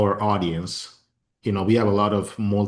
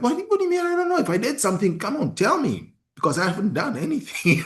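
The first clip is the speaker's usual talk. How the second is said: much higher, baffled and pleading; exasperated pleading; 13 semitones higher, faster than usual. Retold or imitated. Young husband pressing his crying wife for answers, exasperated. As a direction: beg her to just tell you what's wrong. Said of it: "I was like".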